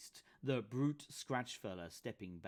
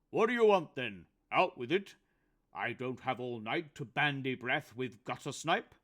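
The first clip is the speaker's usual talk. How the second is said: loud, much higher, haughty retort; indignant old undertaker; 4 semitones higher, 10 dB louder than usual. Acted. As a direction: bark it at the thugs like a proud old man. Phil voiced in dialogue.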